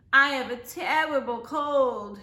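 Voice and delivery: slow, drawn-out, emphatic moan. A narrator's reading. Sick Miss Moore phones her principal, moaning. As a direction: moan it out, laid up with a cold. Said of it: "she moaned".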